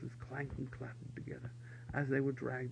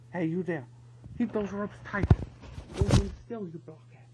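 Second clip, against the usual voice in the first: louder, higher commands; barked orders; 5 semitones higher, 6 dB louder than usual. A narrator's reading. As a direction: bellow at the blockheads. Officer barking orders at soldiers.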